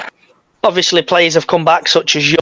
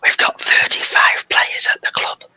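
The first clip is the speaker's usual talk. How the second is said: voiceless mock-secret whisper; 4 dB quieter than usual. Host sharing the squad count as a secret, whispering. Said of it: "Why are we whispering?".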